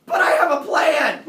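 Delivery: a loud, high, laughing "but I have a plan"; hammed-up lover revealing his plan; excited scheming. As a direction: announce the plan with gleeful excitement. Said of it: "He's like".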